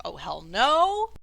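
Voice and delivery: slow refusal swooping up to a loud finish; hammed-up bystander's refusal; acted refusal. Game master voices alarmed bystander.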